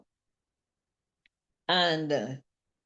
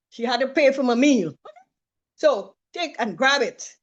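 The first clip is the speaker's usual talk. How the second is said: loud, slow fervent sermon; preaching; 4 semitones higher, 7 dB louder than usual. Acted. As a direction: preach it to the room, full force.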